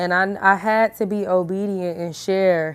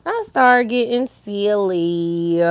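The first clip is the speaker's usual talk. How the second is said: slow drawn-out silly; 3 dB louder, much slower than usual. Real, not acted. To her viewers, admitting she gets silly, slowly drawn out. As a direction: stretch "I start getting silly" out slowly.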